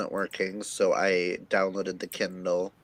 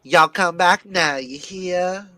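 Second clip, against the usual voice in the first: syrupy Southern drawl, rising higher toward the end; Georgia plantation owner; 5 semitones higher than usual. Shown as a demonstration. Showing friends their closest thing to British, Southern plantation accent. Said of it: "is Georgia plantation owner".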